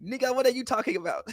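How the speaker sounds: high-pitched mimicry without the volume; funny Giannis impression. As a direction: imitate his funny line way up high. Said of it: "he said it so funny".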